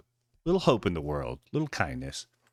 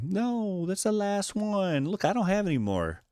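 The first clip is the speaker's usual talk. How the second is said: begging the man for the last cookie; pleading; 11 semitones higher than usual. Acted. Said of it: "she pleads with him".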